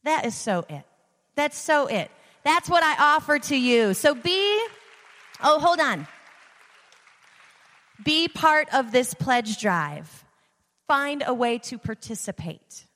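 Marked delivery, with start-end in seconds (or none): 1.3-11.3 booming motivational speech. Acted. Rousing pledge drive pitch.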